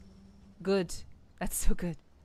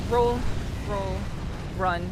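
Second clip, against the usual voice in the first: being chased. Real, not acted. Fleeing enemies in the game.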